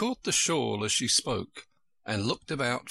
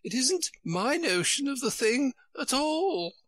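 put-on high, fluttery old queen voice; a high, flustered royal voice. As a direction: play the dithering queen, high and fussy. Queen's voice held by reader.

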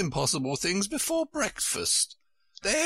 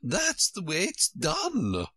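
high, slow and decisive; great decision; 8 semitones higher, slower than usual. A narrator's reading. Queen answering Alice's question, very decided. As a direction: pronounce it firmly, drawn out. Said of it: "said with great decision".

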